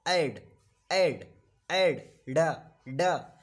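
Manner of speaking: ऐड, ending on a distinct डा sound; final-sound demo. Shown as a demonstration. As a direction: say ऐड with a clear ending, then isolate डा. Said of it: "डा sound निकल रहा है". (ऐड के अंत की डा ध्वनि साफ़ बोलें)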